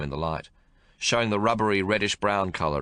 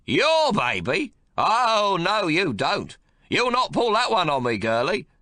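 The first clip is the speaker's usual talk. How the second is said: put-on high, loud, bossy old grandfather; gruff domineering old man; 12 semitones higher, 5 dB louder, slower than usual. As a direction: bark orders at the new mother. Old man voiced in story.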